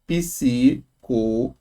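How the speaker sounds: psicologia sounded out slowly, psi-co-lo-gi-a; slow syllable split. Shown as a demonstration. Teacher splitting the word for the class, slow. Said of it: "se não tem acento".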